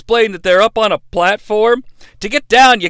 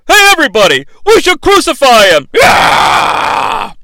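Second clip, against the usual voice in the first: a loud, raised rabble-rousing shout; yell from the crowd; 5 semitones higher, 4 dB louder than usual. Retold or imitated. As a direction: yell the idea to whip up the mob. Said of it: "somebody yells".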